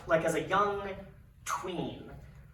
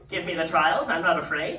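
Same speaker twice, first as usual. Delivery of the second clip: loud, heroic acceptance of a challenge; bold declaration; 10 dB louder than usual. Retold or imitated. Patient accepts the troll's trials, boldly loud. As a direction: stand up loudly to the troll.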